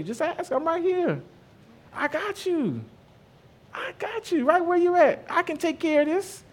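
high, soft, reassuring voice of God; tender God voice. Retold or imitated. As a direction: coax gently, like comforting a child. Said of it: "This is what I hear God saying".

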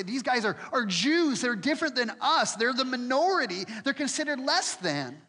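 a hint of an angry mob; mob sneer. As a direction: spit it out like a hostile crowd. Mob accusing Paul and Silas.

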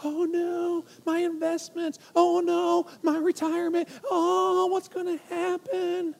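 high, mock panicked wailing. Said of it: "were you freaking out?".

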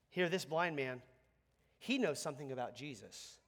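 normal talking voice

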